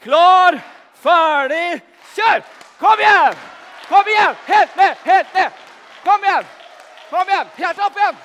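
high, very loud shouts urging the runner; cheering on. Real, not acted. Leader cheering Andreas through his run, loud shouting. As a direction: yell him on down the aisle and back.

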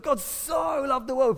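"so" drawn out long and high; emphasis on SO. Retold or imitated. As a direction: exaggerate "so", high and stretched like a sitcom line. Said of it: "we put all of our emphasis on that word".

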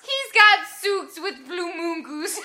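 "souks" and "moon goose" slow and laughing, vowels as ooh; ooh language. Shown as a demonstration. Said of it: "That means socks with flamingos".

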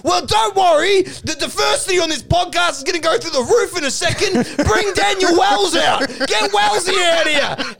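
high, shouted hype; excited hollering. Acted. Duck's acted announcement, calling out.

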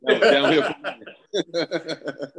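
laughing. Real, not acted. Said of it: "laughing at the loss".

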